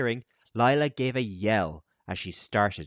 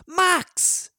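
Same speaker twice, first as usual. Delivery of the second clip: much higher, louder frantic roar; wild roar; 7 dB louder than usual. A narrator's reading. Fred searches wildly for the missing boy, roaring. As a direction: bellow the name in panic. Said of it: "he roared wildly".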